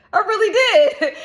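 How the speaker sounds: high, laughing affirmation; delighted laughing insistence. Real, not acted. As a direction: laugh through it proudly. Empowered, laughing.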